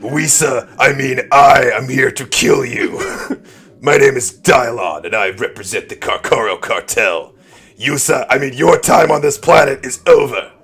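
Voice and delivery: loud, menacing masked-villain promo; booming mock-vigilante challenge; Batman voice. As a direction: call out the enemy in a hammed-up threat. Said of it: "rumbling with Batman voice".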